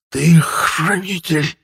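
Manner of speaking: high, slow strangled rasp; drawn-out dying wheeze; squeezed out with effort. A narrator's reading. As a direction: force each word through a throttled throat. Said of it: "выдавил из себя мой противник с великим трудом".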